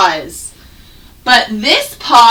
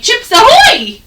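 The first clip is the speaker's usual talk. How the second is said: cheerful exclamation; 12 semitones higher, 4 dB louder than usual. Shown as a demonstration.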